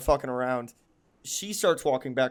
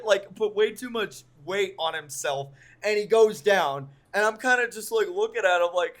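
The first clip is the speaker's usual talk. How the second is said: usual speaking voice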